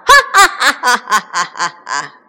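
long, loud gloating laugh; rolling weasel "ha ha ha"; evil villain cackle. A narrator's reading. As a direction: cackle on and on as the gang attacks. Bandit chief laughs as he attacks.

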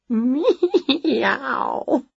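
high, drawn-out meow; cat meow. A narrator's reading. Wilbur the cat titters at Winnie, high meow. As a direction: imitate a cat, sliding pitch.

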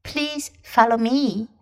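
usual speaking voice

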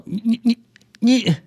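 high, stammering disbelief, pitch climbing; astonished doctor. Acted. Doctor stunned by the patient, high stammering astonishment. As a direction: stutter in shock as the doctor. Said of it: "这医生啊就很惊讶".

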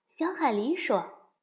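plain reading voice

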